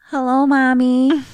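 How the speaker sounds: put-on high, slow, small child's voice; a lost little girl answering. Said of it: "Carol Ann is just like".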